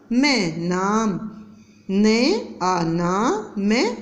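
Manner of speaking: very slow, syllable by syllable; slow spelling out. Shown as a demonstration. Teacher blending sounds for young pupils, very slow. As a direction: stretch each sound for the children. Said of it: "क्या बन गया हमारा?".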